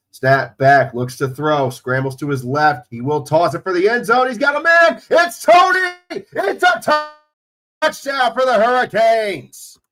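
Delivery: excited football call building to a high, loud yell; announcer erupting over a score; live play-by-play. Real, not acted. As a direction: climb from brisk commentary into a touchdown shout. Calls a touchdown play live.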